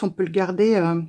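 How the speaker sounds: usual speaking voice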